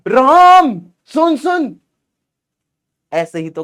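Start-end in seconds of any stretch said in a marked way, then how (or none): 0.1-1.8 Ram सुन called out high, loud and slow; calling the goalkeeper friend over the noise; calling across a field. Pretend. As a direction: shout to your friend across the ground. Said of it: "कहोगे".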